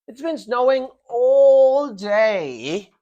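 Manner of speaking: ALL DAY very slow, high and loud; emphasis on duration. Shown as a demonstration. Teacher reading an example to the class, duration emphasized. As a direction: drag out "all day" as if it never ends. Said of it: "The emphasis is on the duration".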